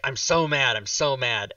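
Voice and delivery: ordinary delivery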